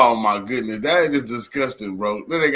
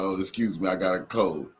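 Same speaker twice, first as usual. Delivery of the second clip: mocking a sick old man; 5 semitones lower, 6 dB quieter than usual. Commentator mocks the president's cold excuse, lower and softer. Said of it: "talking about".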